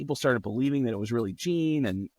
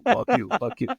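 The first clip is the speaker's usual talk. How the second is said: the same fuck you thrown much higher and louder, topping the other guy; mock shout; 11 semitones higher, 5 dB louder than usual. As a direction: yell fuck you over him to win the fight. Shouting match peaks.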